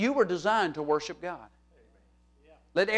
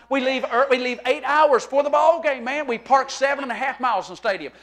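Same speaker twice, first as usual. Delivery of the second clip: ordinary talk, nothing marked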